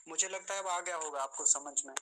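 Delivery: usual speaking voice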